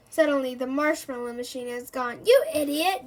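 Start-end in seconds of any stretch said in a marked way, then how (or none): 2.2-3.0 loud, higher angry yell; Terry furious at the professor. Acted. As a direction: hurl the insult. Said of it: "yells Terry".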